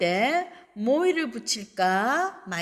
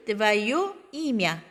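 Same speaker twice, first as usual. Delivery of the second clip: very slow, твоё stressed; slow model reading; much slower than usual. Shown as a demonstration. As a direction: say твоё имя slowly for the class. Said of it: "너의 이름". (천천히 또박또박)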